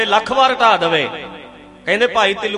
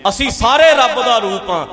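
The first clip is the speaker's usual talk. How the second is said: higher and louder, ਸਾਰੇ hit hard with a falling end; emphatic declaration; 5 semitones higher, 5 dB louder than usual. Real, not acted. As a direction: proclaim it to the whole crowd. ਮੁੱਖ ਗੱਲ ਜ਼ੋਰ ਨਾਲ ਦੁਹਰਾਈ. (ਜ਼ੋਰ ਨਾਲ ਐਲਾਨ, ਸਾਰੇ ਉੱਤੇ ਭਾਰ)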